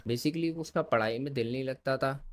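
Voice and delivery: ordinary talk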